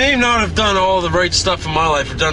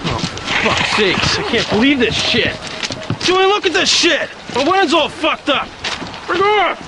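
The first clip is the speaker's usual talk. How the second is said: furious shouting; 12 semitones higher than usual. Acted. Groom yelling at Julian as police ruin his wedding, furious. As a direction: rage as the wedding falls apart.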